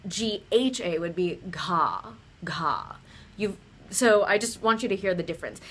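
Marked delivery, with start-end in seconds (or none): 1.5-3.0 gha with a little puff of air; aspirated gha. Shown as a demonstration. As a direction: let air burst out after the G in gha. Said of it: "that little puff of air".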